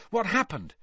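bright, eager question. Acted. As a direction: ask keenly, high and quick. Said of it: "said Harry eagerly".

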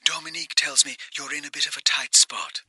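high, thin, hushed phone voice. A narrator's reading. Caller to Porter on the sat phone, distant phone voice. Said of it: "voice on the other end of the line".

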